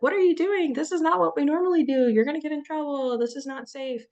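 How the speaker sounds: high, loud, fast alarm; panicked nervous system. Retold or imitated. The nervous system scolding its owner: a high, fast panic. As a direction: sound the alarm like a frightened inner voice. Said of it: "your nervous system is like".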